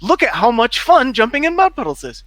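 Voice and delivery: high, gushing mock enthusiasm; hammed-up high-pitched glee; mock excitement. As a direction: imitate an over-excited child, pitched right up. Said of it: "they were like".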